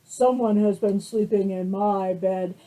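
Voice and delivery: big bear rumbling his complaint; loud papa bear. Acted. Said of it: "the Papa Bear said".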